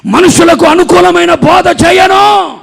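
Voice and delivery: high, very loud, drawn-out declaration; full-force preaching. Real, not acted. Preacher to congregation on his message, thundering declaration.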